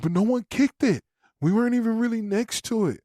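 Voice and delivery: high, pleading daughter voice; Hazel's protest. Retold or imitated. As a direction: voice the scared girl pitched well up.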